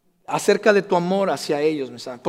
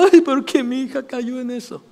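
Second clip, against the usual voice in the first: lamenting parent; 7 semitones higher than usual. Retold or imitated.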